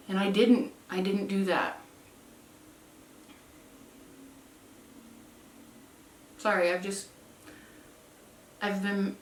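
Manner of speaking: softly choked up, halting; trailing into a quiet, real apology between long pauses; getting emotional. Real, not acted. Telling viewers she didn't give in, choked up. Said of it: "getting so emotional".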